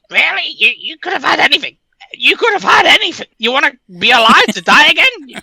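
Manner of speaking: can't believe the choice; incredulous God. Acted.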